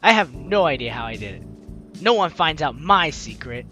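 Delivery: ordinary delivery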